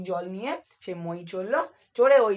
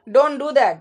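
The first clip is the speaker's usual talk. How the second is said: loud, higher cry of protest; shouted; 4 semitones higher, 9 dB louder, much slower than usual. A narrator's reading. Taffy being shaken by the collar, shouting. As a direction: yell it to make him stop. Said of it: "he shouted".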